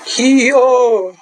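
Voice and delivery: loud high martial arts cry; hee-haw karate shout; kung fu noise. Real, not acted. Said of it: "kung fu noises whenever I'm drawing".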